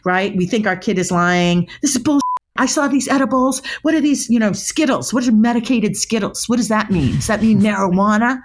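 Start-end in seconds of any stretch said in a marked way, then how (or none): 1.8-8.4 higher, mock-angry parent grilling a kid. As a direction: play the furious parent who found edibles. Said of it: "and we get angry".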